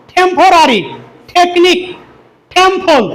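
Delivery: puffed t opening "টেকনিক" and each word; correct aspirated pronunciation. Shown as a demonstration. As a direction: breathe out on the t of "টেম্পল". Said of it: "শুদ্ধ উচ্চারণ কি হবে?".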